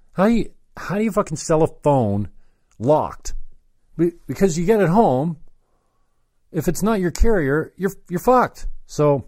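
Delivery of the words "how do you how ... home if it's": higher-pitched incredulous question; retold exasperation. Customer fuming about a locked phone, exasperated. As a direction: replay your disbelief, higher at first.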